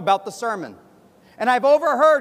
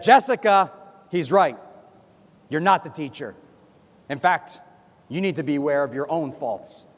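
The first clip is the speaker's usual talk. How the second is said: lightly voiced stern teacher, lower-pitched; retold scolding, dropping low after the first name; Madam Din; 7 semitones lower than usual. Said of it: "Madam Din yelled at both of us".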